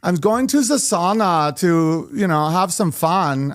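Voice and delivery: slow Swedish accent impression; slow mock Swedish accent. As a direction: lilt it like a Swede heading to the sauna. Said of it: "or even Swedish".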